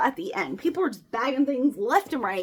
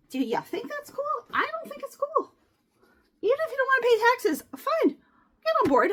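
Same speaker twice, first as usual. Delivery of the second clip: indignant rant; 10 semitones higher, 4 dB louder than usual. Real, not acted. Rant to the absent lady.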